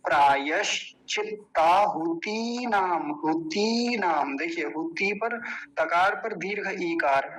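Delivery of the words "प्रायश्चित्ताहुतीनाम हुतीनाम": हुतीनाम with the ती held long; slow recitation lengthening ती; long ee vowel. Shown as a demonstration. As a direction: stretch the "tee" in हुतीनाम. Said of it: "बड़ी ई की मात्रा है".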